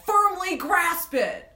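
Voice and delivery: high, loud, drawn-out yell; hammed-up yell. Fan yelling a SpongeBob line for listeners, hammed-up yell. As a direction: yell it slowly like a cartoon command. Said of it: "I really wanted to yell".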